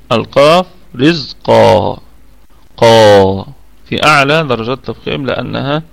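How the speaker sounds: القاف رزقا slow, qaf deeply heavy. Shown as a demonstration. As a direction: push رزقا out with full tafkhim. (القاف مفخمة ببطء)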